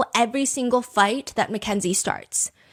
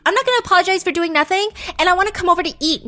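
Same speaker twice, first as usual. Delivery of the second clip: heated; 10 semitones higher, 6 dB louder, much faster than usual. Acted. Mackenzie's heated DM.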